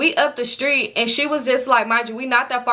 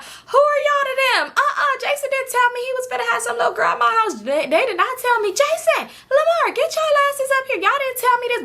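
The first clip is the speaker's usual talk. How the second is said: playfully fake-furious older woman calling out the boys; mock outraged lady; 14 semitones higher than usual. Lady at the party pretending outrage at Jason and Lamar, high mock shouting. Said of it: "But she just playing, like, laughing".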